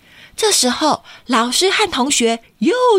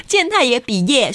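usual speaking voice

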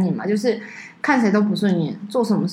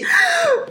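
高音调的惊讶倒吸一口气; 学大家看信时倒吸一口凉气; 夸张倒吸气. Retold or imitated. Friends react to the letter. (尖声倒抽一口气)

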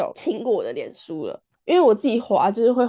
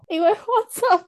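squeaky, pitched way up; high squeaky voice; 10 semitones higher than usual. Pretend. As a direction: answer in a high, whiny squeak. Finishing the joke punchline.

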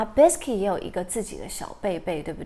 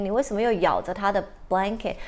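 ordinary delivery

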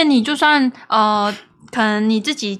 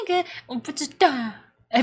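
normal talking voice

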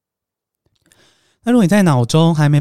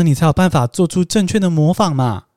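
normal talking voice

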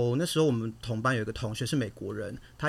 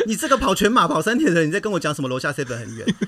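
louder, higher teasing through laughter; laughing mock disbelief; 5 semitones higher, 8 dB louder than usual. Teasing her friend about the walk downstairs, laughing. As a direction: laugh while scolding a lazy marathoner. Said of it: "然后我就想说".

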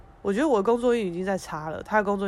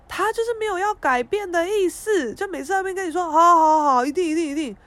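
mocking empty promises; 10 semitones higher, 7 dB louder than usual.

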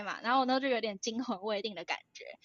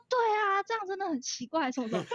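mock agreement; 8 semitones higher than usual. Playing along with mom's outrage.

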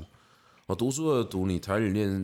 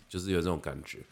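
normal talking voice

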